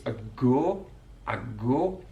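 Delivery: a go with one long flat o, no glide; Mackem monophthong. Shown as a demonstration. Teacher showing the Mackem long flat o in a go. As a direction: hold the o of a go as one pure vowel, Mackem style. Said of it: "elongated singular monophthong sound".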